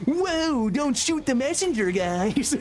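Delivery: high, defensive whoa, hands up; mock innocent protest. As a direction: play it falsely innocent. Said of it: "I can be like".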